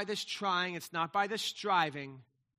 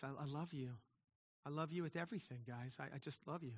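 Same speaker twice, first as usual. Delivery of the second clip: soft, lowered voice full of affection; tender love to sons; 4 semitones lower, 12 dB quieter, faster than usual. Retold or imitated. A father tells his boys softly he loves them. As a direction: tell your boys gently you love them. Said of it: "I'll say to my boys".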